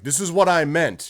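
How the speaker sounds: loud, high mock dragon proclamation; mock-grand announcement from on high; dragon proclaiming. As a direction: boom it out as a descending dragon. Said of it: "Eberron, the dragon, coming down and being like".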